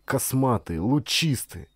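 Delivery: usual speaking voice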